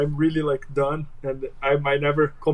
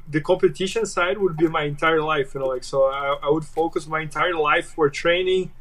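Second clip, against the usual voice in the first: ordinary talk, nothing marked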